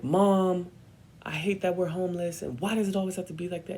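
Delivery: high, fast, whiny complaint; staged argument. Retold or imitated. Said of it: "I start an argument with her".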